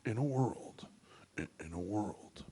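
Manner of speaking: low, measured trailer-voice opener; deep film-promo impression; movie trailer announcer. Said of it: "commercials for the trailer movies".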